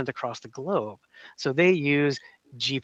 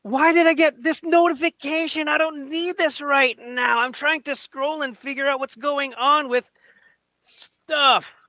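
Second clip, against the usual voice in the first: overreact like a notification ruined his scroll; overreaction; 9 semitones higher, slower than usual. Acted.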